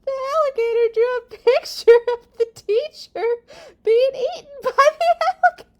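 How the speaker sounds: very high, loud, rushed squeal; shrieking disbelief. Acted. Reader reacting to teacher drawn being eaten, high loud shriek. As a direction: squeal it in hammed-up shock. Exclaims over the alligator's drawing.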